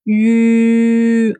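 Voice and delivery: U said with kissing lips, a long ü. Shown as a demonstration. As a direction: pucker up and say U as ü. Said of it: "Form your lips like you're getting ready to kiss".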